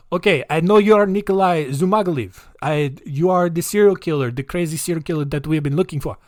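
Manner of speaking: put-on comic Russian-accented accusation; Russian officer's accent. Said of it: "it's just like".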